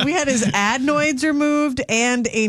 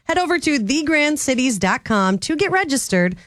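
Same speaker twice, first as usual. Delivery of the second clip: promo read; 5 semitones higher than usual. Acted.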